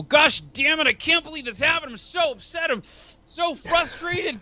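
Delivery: hammed-up frustrated yell, dragged slowly at the end; frustrated outburst. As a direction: vent like the five minutes are unbearable. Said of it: "you go".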